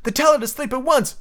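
put-on high, insistent boy's demand; half-command, pitched well up; almost ordering. A narrator's reading. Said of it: "John almost ordered".

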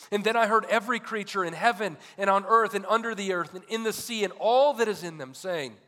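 loud, high, urgent pulpit delivery; full-force preaching. Real, not acted. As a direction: preach it out to the whole congregation.

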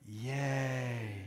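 soft, drawn-out, tender yay; gentle yay. Acted. Preacher voicing what God always says, soft gentle yay. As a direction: say the cheer softly and let it linger. God's gentle answer after game.

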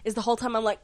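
ordinary talk

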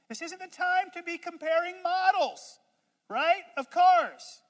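high, loud scolding of the cheerful one; exasperated Eeyore rant. Pretend. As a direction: berate him like he's lost his mind. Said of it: "us Eeyores think".

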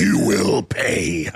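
slow menacing villain voice; Emperor voice. Pretend. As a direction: threaten slowly like a sith lord. Star Wars Emperor impression.

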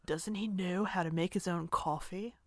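understated celebrity impersonation; Keanu. Acted. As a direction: do a low-key actor impression, a bit subdued. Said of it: "a one-off thing of Keanu going".

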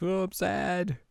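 high, small-voiced moping; hammed-up little sulk; mock sadness. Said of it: "flops on her bed and is like".

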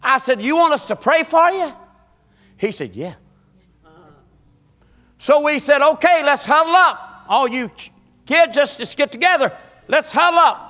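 high, loud revival storytelling; fervent preacher in full flow; preaching at full force. Acted. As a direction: drive the story home to the congregation. Preacher retells comforting the boy.

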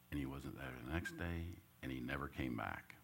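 hushed retelling of the loss. A narrator's reading. Dad leaving retold twice.

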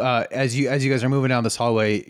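usual speaking voice

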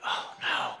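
low breathy oh no; hushed sinking oh no; whispered dismay. Retold or imitated. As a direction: breathe it out in quiet despair. Reacting to no medicine.

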